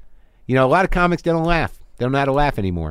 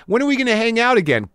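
higher, chirpy fake warmth; fake friendly; 9 semitones higher, faster than usual. Shown as a demonstration. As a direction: sound eager to be pals. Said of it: "I was talking to a made-up person".

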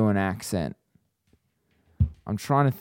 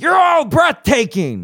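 much higher, shouted put-on voice; Keanu shouting; 9 dB louder than usual. Keanu impression peaking into a loud shout. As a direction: shout it to the whole crowd. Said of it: "I want you to do Winston".

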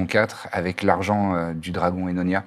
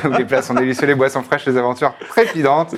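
upbeat host welcome, raised and louder; show intro; 4 semitones higher, 5 dB louder than usual. Acted. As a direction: open the show like a tavern host.

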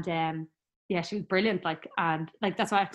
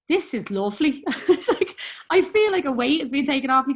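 a laugh in the voice, louder and higher; laughing relief; 7 semitones higher, 7 dB louder than usual. Retold or imitated. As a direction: giggle with relief. Relief during section, laughing.

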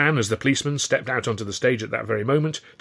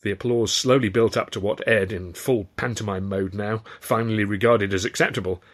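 ordinary delivery

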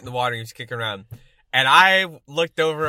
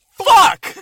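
high, loud mock-child shout; proud little-kid yell; 14 semitones higher, 9 dB louder than usual. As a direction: show off the new word at full volume. Imitating kid yelling to his dad.

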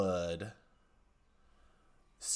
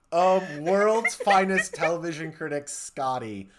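ordinary delivery

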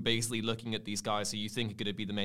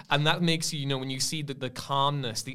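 ordinary talk

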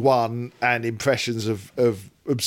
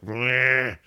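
higher-pitched grumbling "meh"; mock disgruntled meh; 4 semitones higher than usual. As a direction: bleat out a sulky meh. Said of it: "he was like".

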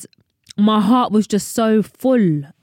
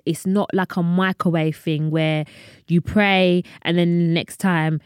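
usual speaking voice

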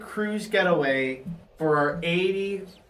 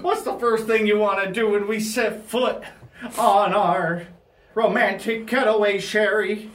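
put-on doting old husband, pitched up; Barry Ovaltine; 5 semitones higher than usual. Husband to wife before their birthday cruise, higher old-man voice. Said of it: "I'm Barry Ovaltine".

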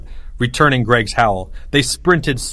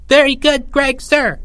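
high, squeaky little subordinate; small voice; 14 semitones higher, 3 dB louder than usual. A narrator's reading. Third-grade leader answering his general, small voice. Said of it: "said a small voice".

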